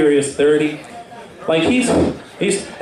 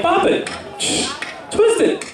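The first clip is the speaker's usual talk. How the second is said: Bop It toy voice; 13 semitones higher than usual. Pretend. The comedian voicing the Bop It toy for the crowd, high commands. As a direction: bark the toy's orders way up high.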